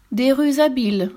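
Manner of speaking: high, indignant echo; scoffing storybook exclamation; incredulous cry. A narrator's reading. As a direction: cry it back with pitch lifted. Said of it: "s'écria le pas si gros".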